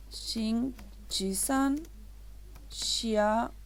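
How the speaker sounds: "Shinchi-san, xiao" syllable by syllable; slow dictation. Shown as a demonstration. Teacher writes the sentence in Chinese, slow dictation of "Shinchi-san, xiao". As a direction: draw out "Shinchi-san, xiao" with gaps between parts.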